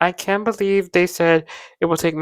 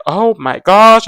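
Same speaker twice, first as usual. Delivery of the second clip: slow, louder drawn-out gasp of words; mock shock; 3 semitones higher, 9 dB louder, much slower than usual. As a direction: imitate stunned parents, stretching it out. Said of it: "they were shocked".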